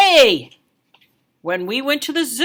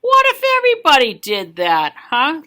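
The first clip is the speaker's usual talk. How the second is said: louder scolding; stern waitress; 3 semitones higher, 5 dB louder than usual. Acted. Waitress scolding the kid at dinner, loud. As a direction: scold with a pointed huh. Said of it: "looked straight at me and said".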